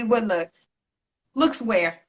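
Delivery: mock-sweet, dismissive baby; lightly voiced put-down, looking them up and down; sassy retort. To the men's women, a lightly sassy put-down. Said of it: "I say".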